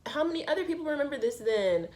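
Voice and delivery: high, loud incredulous question; stunned at who remembers it; shocked disbelief. Retold or imitated. Recalling embarrassment to friend.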